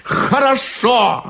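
very high, insistent cry. A narrator's reading. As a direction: push the pitch right up, insisting. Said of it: "повысил голос изящный чёрт".

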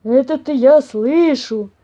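slow, loud bear growl. A narrator's reading. Papa bear answering unseen mice, growling. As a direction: growl it out heavily. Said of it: "прорычал папаша Бамсе".

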